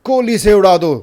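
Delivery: loud, high-pitched call for execution; outraged cry. Acted. Someone in the crowd demanding a hanging, loud outraged cry. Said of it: "कोई कहता है".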